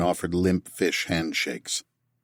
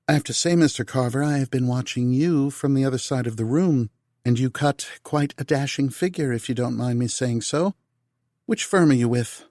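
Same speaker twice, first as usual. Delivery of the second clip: higher, playful flirt as Tal; Tal's voice; 6 semitones higher than usual. A narrator's reading. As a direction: flirt teasingly with a stranger.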